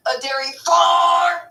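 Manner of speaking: high, loud, slow yell; shouted punchline. Pretend. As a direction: bellow the punchline like a game show answer. Shouting answer as catchphrase.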